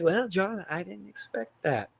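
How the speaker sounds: hushed deadpan professor; quiet dry reply. Retold or imitated. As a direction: murmur it, taken aback but composed. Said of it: "he's like".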